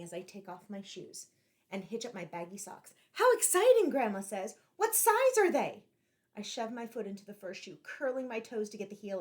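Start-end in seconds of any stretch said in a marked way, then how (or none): 3.1-3.9 high, loud delighted exclamation; excited grandma. Acted. Grandma thrilled at the thrift shop window, high and excited. 4.8-5.8 high, loud and slow eager question; eager grandma. Acted. Grandma eagerly asking the boy about the shoes, high and loud.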